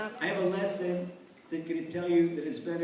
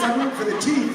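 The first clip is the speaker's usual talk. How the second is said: loud, higher fan's answer; gleeful punchline; 6 semitones higher, 7 dB louder, faster than usual. Acted. Fan answering the man next to him, loud and high. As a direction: belt out the punchline with a laugh.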